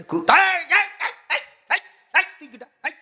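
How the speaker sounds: high-pitched repeated calls of "hey"; acted challenge. Guard dog confronts a visitor at the house, acted scolding. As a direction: challenge an intruder in short, high bursts.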